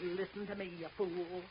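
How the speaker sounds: low urgent scolding under the breath; hushed scolding. Acted. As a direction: keep it down but make it bite. Wife scolds husband in private.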